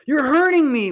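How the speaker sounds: high, loud cry imitating the man's protest; mock pained yell. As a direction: imitate him wailing in protest. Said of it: "At one point, he goes".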